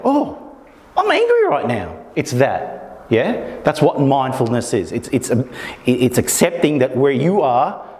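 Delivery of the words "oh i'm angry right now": noticing, light realisation. Shown as a demonstration. Teacher showing how a mindful person notices anger, lifted high. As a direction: jump high on Oh, high gentle noticing. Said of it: "It's about".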